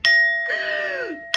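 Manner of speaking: sharp high gasp of delight; real thrilled gasp; excited gasp. Real, not acted. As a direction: gasp like you just saw a sign. Reacting to the butterfly.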